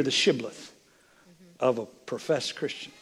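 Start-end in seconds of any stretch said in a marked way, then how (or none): none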